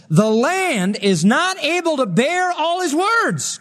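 high, drawn-out proclamation; accuser's declaration. Acted. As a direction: stretch each phrase in indignant accusation. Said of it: "this is what he said".

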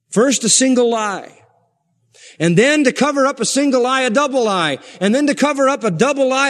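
high, insistent pulpit build; forceful preaching. Real, not acted. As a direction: pound out each point to the congregation.

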